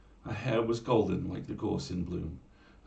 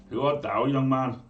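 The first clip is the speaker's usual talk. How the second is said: loud, higher demanding call; gruff challenge; 5 semitones higher, 8 dB louder than usual. Acted. Smith calls out to a stranger at his door, loud. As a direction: bark the question at the stranger.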